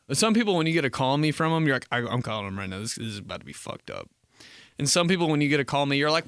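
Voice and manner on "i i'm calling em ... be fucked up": soft, low, worried aside; acted dread. Reacting to a call me text, worried mutter to oneself. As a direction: drop low and quiet, bracing for trouble.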